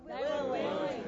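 the congregation murmurs willing; answered softly from the room; distant crowd answer. Real, not acted. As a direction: congregation reply, off mic.

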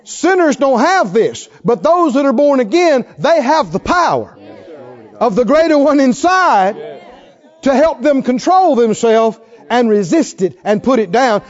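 loud, high revival preaching; full-force pulpit preaching. Acted. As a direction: preach it out with fire.

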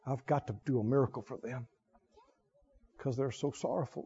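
mock God caving in to tears, low and quick; melting God. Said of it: "He just melts and goes".

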